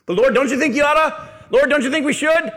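high, loud mock whine; whiny nagging disciple. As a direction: ham up a pestering follower.

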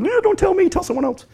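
high, pleading inner cry; a pastor dreading the next problem; panicked inner voice. Retold or imitated. Pastor's inner voice as people share problems, high panicked plea. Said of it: "I'm inside going".

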